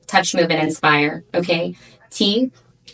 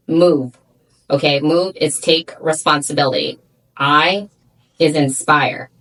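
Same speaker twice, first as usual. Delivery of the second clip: normal talking voice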